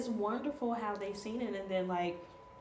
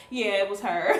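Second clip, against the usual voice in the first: much louder proud answer; proud exclaim; 11 dB louder than usual. Retold or imitated. Mother proudly confirming to the stranger, loud. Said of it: "I was like".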